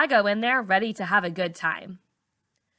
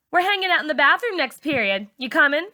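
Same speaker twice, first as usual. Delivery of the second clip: put-on high pushy teen girl; pressing, pitched up high; Char's voice; 8 semitones higher, 3 dB louder than usual. A narrator's reading. Char inviting to skip class.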